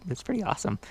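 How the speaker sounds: normal talking voice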